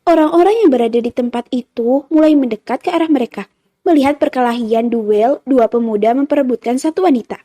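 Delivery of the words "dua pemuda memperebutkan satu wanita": ordinary delivery, nothing marked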